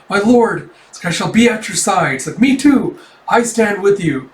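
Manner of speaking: acted stirred-up loyalty; eager pledge. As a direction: pledge yourself with fervor. Moved soldiers pledge loyalty.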